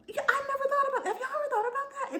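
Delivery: very high-pitched astonishment; replayed mind-blown reaction; amazed realisation. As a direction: squeal it like a sudden revelation. Replaying her own amazed realisation.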